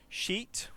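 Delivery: sheet whispered with a long clear ee; whispered demo word. Shown as a demonstration. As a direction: breathe sheet out with a crisp long ee. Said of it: "let me say some words".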